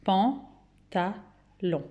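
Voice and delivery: "Pantalon" very slowly, cut into pan, ta, lon; measured teacher's count of "Pan-ta-lon"; syllable by syllable. Shown as a demonstration. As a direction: pause after each syllable of "Pantalon". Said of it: "trois pour les trois syllabes".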